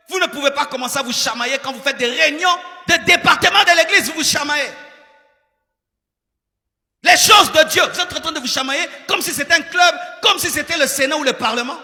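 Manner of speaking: loud, fast, rising pulpit rebuke; preaching at full force. Acted. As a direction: drive it home to the congregation.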